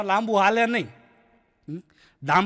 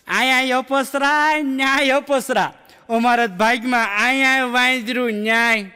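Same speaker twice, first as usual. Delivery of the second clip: very slow, drawn-out complaint, a little louder; mock lament; 3 semitones higher, 3 dB louder, slower than usual. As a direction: stretch each word like a wife's mock grievance. (પત્નીની ધીમી, લંબાવેલી મજાકિયા ફરિયાદ)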